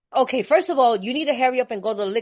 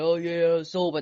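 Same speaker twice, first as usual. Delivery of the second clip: low, dull mock of sobriety; mock bored sober; 6 semitones lower than usual. Mocking a joyless sober member, low and dull. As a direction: droop it like sobriety is a chore. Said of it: "I'm not gonna be around here going".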